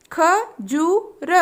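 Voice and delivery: खजूर stretched very slowly, syllable by syllable; slow drawn-out word. Shown as a demonstration. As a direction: draw out खजूर for children. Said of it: "तीन वर्ण वाले शब्द".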